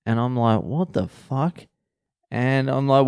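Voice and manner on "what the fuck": slow, hushed, high-pitched disbelief; stunned. Retold or imitated. Comedian retells meeting a fellow Australian abroad, stunned. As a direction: draw it out softly, pitched up, astonished.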